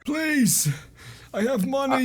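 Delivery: high, strained begging voice; wounded captive. Acted. Tied-up man offers money to his rescuer, desperate high plea. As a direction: plead like a badly beaten prisoner. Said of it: "looks up at you and he says".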